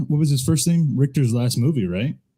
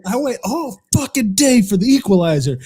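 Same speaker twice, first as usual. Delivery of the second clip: higher-pitched movie-dad outburst; mock outraged rant; 8 semitones higher than usual. Dad cursing a late phone call, mock outraged. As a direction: imitate a dad furious at the phone.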